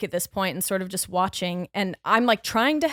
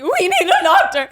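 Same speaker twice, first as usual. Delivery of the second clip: high, loud, half-laughing call for help; urgent call for a doctor; 9 semitones higher, 10 dB louder than usual. Retold or imitated. She shouts to the cabin for a doctor, urgent and loud. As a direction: cry out that you need a doctor. Retelling her cry for help.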